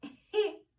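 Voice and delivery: loud roaring breath out, tongue out; lion's breath exhale. Shown as a demonstration. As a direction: exhale hard like a lion. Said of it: "when you breathe out your mouth".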